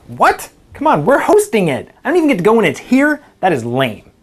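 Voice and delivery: loud, high-pitched indignant outburst; indignant protest. Acted. Employee refused the Hawaii conference trip, indignant.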